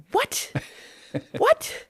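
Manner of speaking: high, incredulous repeated what; stunned disbelief. Retold or imitated. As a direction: squeak out the disbelief. Stunned mom reaction.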